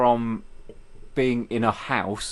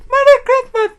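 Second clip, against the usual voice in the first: louder, high squawky bird imitation; magpie voice; 12 dB louder than usual. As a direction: screech it like a talking magpie. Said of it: "Can we have".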